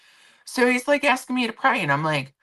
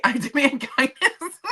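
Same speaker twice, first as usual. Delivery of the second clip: high, slow, through laughter; giggly mock demand; laughing catchphrase; 11 semitones higher, slower than usual. Real, not acted. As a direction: crack up while making the demand. Repeats her catchphrase while laughing.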